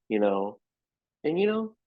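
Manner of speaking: ordinary talk, nothing marked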